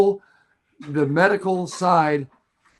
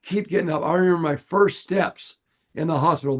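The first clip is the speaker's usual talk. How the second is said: ordinary talk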